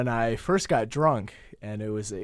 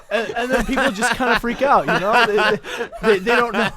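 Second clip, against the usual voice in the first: laughing; 10 semitones higher, 10 dB louder than usual. Real, not acted. Laughing after his mock shout.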